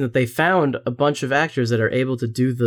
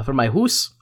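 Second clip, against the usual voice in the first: put-on Minnesotan accent. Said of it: "Sarah Palin kind of thing".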